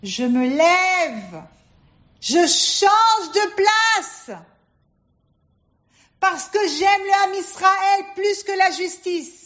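high, drawn-out declaration from the bench; impassioned judge pronouncing out of love; proclaiming judge. Acted. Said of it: "le juge, il dit".

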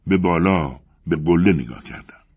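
usual speaking voice

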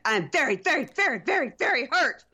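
high-pitched mock outburst, each VERY hit hard; angry horror-film girl. Co-host mimicking the hurt girlfriend turning scary, high angry mimicry. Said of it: "She gets all angry, and she turns into, like, that girl from, um, The Ring".

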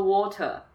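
water with a schwa ending and no R; British water. Shown as a demonstration. Giving a schwa example, British water. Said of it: "water后面那个T E R就是用schwa去发".

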